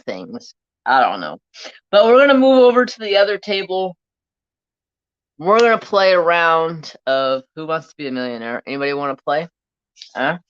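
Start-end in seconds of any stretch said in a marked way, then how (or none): 1.9-8.6 excited announcement. Real, not acted. Announcing a game to chat.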